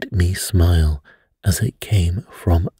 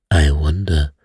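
non-committal; 3 semitones lower than usual. A narrator's reading. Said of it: "said Poirot non-committedly".

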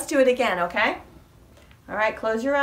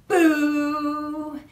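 playful boo; 6 semitones higher, 5 dB louder than usual. Acted. Mouse says boo in rhyme.

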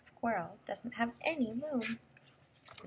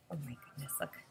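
ordinary delivery

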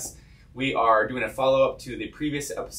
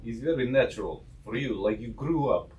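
put-on Russian accent. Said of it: "you do Russian accent".

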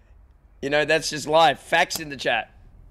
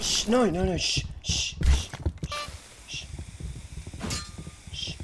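hushed no's between long shushes; urgent hushing; 7 dB quieter than usual. Pretend. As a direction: shush them to keep hidden. Hushing while hiding in game.

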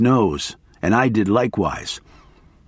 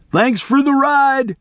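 much higher, slow nervous call; yelled thanks; 14 semitones higher, slower than usual. A narrator's reading. Hitchhiker's high nervous yell to the two men. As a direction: shout thanks while backing away. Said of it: "he yelled".

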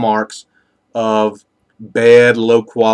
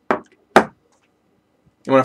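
talking around pipe stem; words through clenched pipe; pipe in mouth; 3 semitones higher, slower than usual. Real, not acted. Seen 1: Pipe stem in mouth at 0.1, removed by 0.4.

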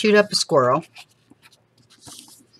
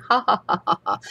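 mock laugh; 5 semitones higher, faster than usual. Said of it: "He's laughing his butt off".

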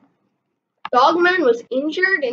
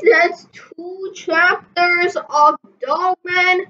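ordinary delivery

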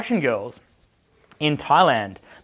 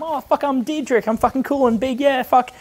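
high-pitched, boastful chatting-up voice; put-on excited show-off; hyped party guy; 11 semitones higher than usual. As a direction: play yourself up to the girls, pitched way up. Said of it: "When you're talking to the girls".

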